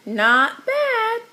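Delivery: high parrot chatter, repeating the words. A narrator's reading. Parrot Petey echoing Danny, high chattering squawk. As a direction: chatter it back like a pet parrot. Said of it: "chattered Petey".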